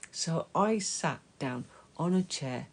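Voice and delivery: ordinary delivery, nothing marked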